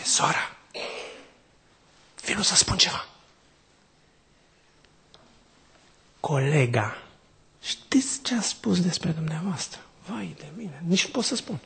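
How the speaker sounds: a secretive mother whispering gossip to the nurse; mysterious hushed gossip. Retold or imitated. Mother secretly tells the hospital nurse gossip, mock mysterious whisper. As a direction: lean in and let the secret slip out, half-voiced. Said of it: "o face așa pe misterioasă".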